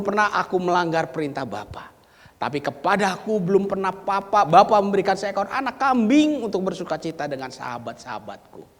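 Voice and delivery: loud, high-pitched complaint; angry elder son. Acted. Elder son angrily protesting to his father. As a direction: voice the son's bitter protest. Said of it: "Anak sulung ini berkata kepada ayahnya".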